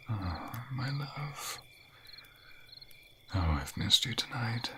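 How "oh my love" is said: very slow and soft, stretching love; tender sigh. Acted. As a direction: melt into the words, slow and hushed. Tender longing.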